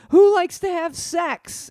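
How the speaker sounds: high, loud, slow hammed-up host question; cheesy stage question. Comic asking the audience a cheap question, hammed up. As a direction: bait the crowd like a cheap comic. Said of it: "or you say something like".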